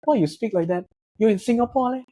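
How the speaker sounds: light Singlish accent, mocking the locals; a hint of Singlish, teasing. Filipino in Singapore mimicking how locals mock him, light Singlish accent. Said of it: "yung Singlish nila".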